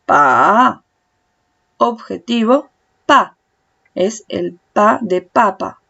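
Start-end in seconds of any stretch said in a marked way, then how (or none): 0.0-0.8 PA drawn out, dipping low then rising; third tone. Shown as a demonstration. Teacher showing tones of pa, PA dipping low. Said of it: "Primer tono". 3.0-3.3 PA short, loud, falling from high; fourth tone. Shown as a demonstration. Teacher showing tones of pa, PA falling. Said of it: "Primer tono".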